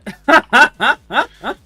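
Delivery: sudden high burst of laughter; bright real laugh. Real, not acted. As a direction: laugh out loud at the broken mod. Laughs at frozen game.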